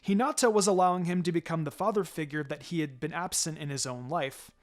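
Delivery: ordinary delivery, nothing marked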